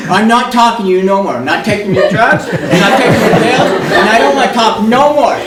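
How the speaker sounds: high, loud, laughing defiance; stubborn refusal. Retold or imitated. Ida refusing the nurses' pills, loud and stubborn. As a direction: dig in, refuse everything.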